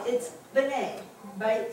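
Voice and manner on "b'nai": "B'nai" as buh-NAY, first vowel barely there; clipped little B onset in "B'nai", stress on the second syllable; short schwa. Shown as a demonstration. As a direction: say "B'nai" with just a quick B sound before NAY. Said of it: "just like a B sound".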